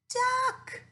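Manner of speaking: high, loud surprised reveal. Acted. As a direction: pop the word out in delighted surprise.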